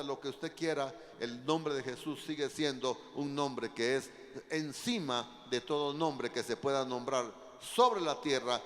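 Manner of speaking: loud, driving sermon proclamation; forceful preaching. Real, not acted. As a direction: hammer out each phrase to the congregation. Preacher proclaims Jesus' name.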